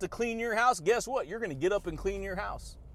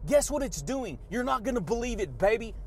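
ordinary delivery

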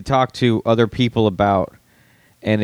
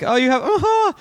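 high, knowing uh-huh. Retold or imitated. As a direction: sound pleased and in on it. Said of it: "he's like".

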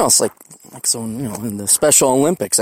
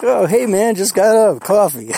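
sleepy friend; 8 semitones higher, 4 dB louder than usual. Retold or imitated. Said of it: "And he's like".

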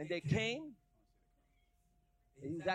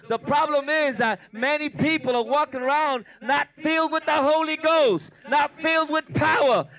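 loud, raised, driving sermon shout; full-force preaching; 8 semitones higher, 11 dB louder than usual. Real, not acted. Preacher warns would-be pastors about powerless ministry, shouting. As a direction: thunder it out as a warning to the church.

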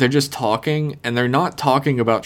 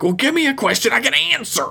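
a much higher, faster retold snap; mock snapping; 13 semitones higher, faster than usual. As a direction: fire it back like a therapist who has lost patience. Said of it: "snaps at her".

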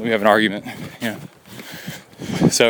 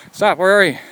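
raised voice calling out to find him; 7 semitones higher, 4 dB louder than usual. Real, not acted. Father calling his son on the trail, raised voice. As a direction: call him loudly to stop.

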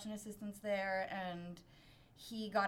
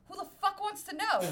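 defiant, higher and louder retort; Brooklyn tough reply; 9 semitones higher, 4 dB louder than usual. Retold or imitated. To a stranger asking her name, a defiant challenge. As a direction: bark back like a Brooklyn local. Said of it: "my immediate reaction was".

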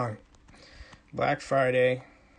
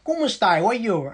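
Sicilian friend; 5 semitones higher, slower than usual. Retold or imitated. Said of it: "and he goes".

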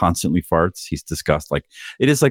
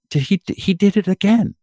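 amazed disbelief; 11 semitones higher than usual. Retold or imitated. Retelling the repeat fart.

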